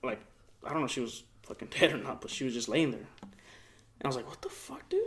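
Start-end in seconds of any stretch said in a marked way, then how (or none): 4.3-5.0 hushed disbelief, barely voiced; shocked whisper. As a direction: whisper it in alarm. Whispered shock in scary house.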